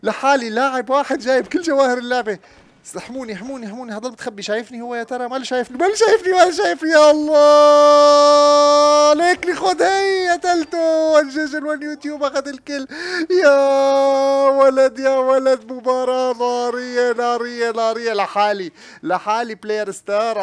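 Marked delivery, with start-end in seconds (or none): 0.0-2.4 loud high excited yells about winning; excited shouting. Real, not acted. 5.3-6.9 from a normal voice to high loud yells; excited building shout. Real, not acted. Hiding with gems, rising excitement. 6.9-20.4 very high loud drawn-out yells; ecstatic victory screaming. Real, not acted.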